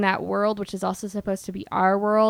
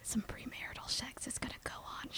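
whispering; 3 semitones higher, 19 dB quieter, faster than usual. Pretend. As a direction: whisper so nobody overhears. Whispering beside the Jesus statue.

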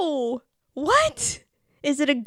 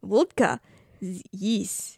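lower, mock Russian accent; 4 semitones lower, 3 dB quieter, slower than usual. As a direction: echo in a put-on Russian accent. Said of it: "do the Russian accent".

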